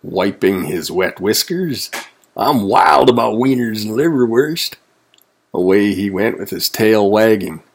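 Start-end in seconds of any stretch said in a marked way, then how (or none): 2.4-4.7 higher, relishing exclamation; gleeful cry. A narrator's reading. Wolf wipes wet whiskers, crying out with glee. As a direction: gush lightly about a favorite food. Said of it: "cried the wolf".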